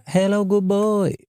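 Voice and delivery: high, slow sing-song greeting; lightly voiced lady greeting a client; imitating the masseuse. Imitating the masseuse greeting him at the spa, high and slow. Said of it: "there's one woman that's always like".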